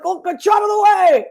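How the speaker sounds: high, loud mock yell of get out of the way; mock shout. Retelling her uncle hauling a tree, a high mock yell. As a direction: bellow it like the dad hauling a tree. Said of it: "he'd be like".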